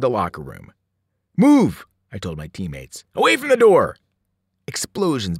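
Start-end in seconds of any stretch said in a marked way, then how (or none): 1.4-1.8 loud, high, urgent command; shouted order. A narrator's reading. Urgent order to teammates in battle. 3.1-4.0 loud, high, urgent command; shouted order. A narrator's reading. Urgent order to teammates in battle.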